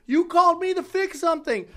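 high, loud indignant protest; mock protest. The dad protesting to the guards, high mock indignation. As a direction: squeak it out like a caught repairman.

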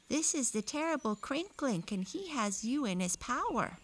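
high warning from a wolf's head. A narrator's reading. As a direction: pitch it up for a talking wolf button. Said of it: "the wolf's head, which had spoken".

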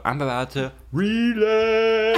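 half-joking slow high drawl; mock ominous. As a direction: drag the line out in a silly ominous voice. Joking about relapsing in Mexico.

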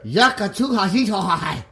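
put-on clumsy learner's Russian accent; attempted Russian accent. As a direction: badly imitate a Russian speaker. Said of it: "try to do a Russian accent".

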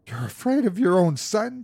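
high-pitched, incredulous challenge; struggling cry. A narrator's reading. Wife challenges her frightened husband, struggling cry. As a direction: cry it out while fighting to get loose. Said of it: "she cried, struggling".

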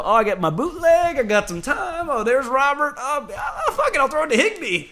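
high-pitched, mock-excited player; giddy quarterback impression. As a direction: mimic a quarterback delighted by his options. Mimics quarterback's giddy thinking on a play.

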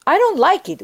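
put-on a child-like protest about the sister's fall, frustrated; frustrated complaint. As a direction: push back against what happened, annoyed.

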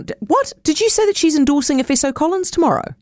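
a sudden high "what?" that stays high through the question; startled disbelief. Real, not acted. Host interrupting the reporter, startled, high-pitched disbelief. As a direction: caught off guard, jumping in to check the news.

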